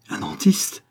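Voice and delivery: high, whispered disbelief; stunned murmur. A narrator's reading. Husband reads the stranger's card, dumbfounded murmur. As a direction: whisper it in amazement, pitch lifted. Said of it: "murmura Guérac abasourdi".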